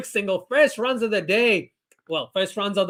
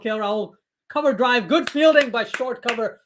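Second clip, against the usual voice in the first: usual speaking voice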